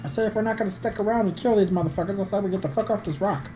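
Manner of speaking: usual speaking voice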